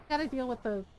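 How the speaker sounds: ordinary talk, nothing marked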